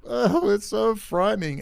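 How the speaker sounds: high, loud mock panic; sarcastic high squeal of fear; mock fright. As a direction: ham up being terrified. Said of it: "I'm being sarcastic".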